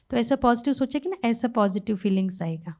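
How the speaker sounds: ordinary talk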